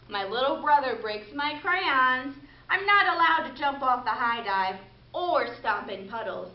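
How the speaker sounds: lively picture-book reading voice; storytelling. Acted. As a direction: read it to children with expression. Reads a picture book aloud.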